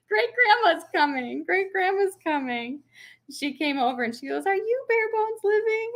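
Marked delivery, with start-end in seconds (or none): 0.1-2.8 high imitated toddler announcement, repeated; two-year-old. Toddler announces the visitor to mother, imitated high child voice. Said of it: "my two-year-old, goes". 4.5-5.9 very high imitated old lady; old lady neighbor. Old neighbor at the door asks the vlogger, high imitated voice. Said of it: "she's an old lady with a cane".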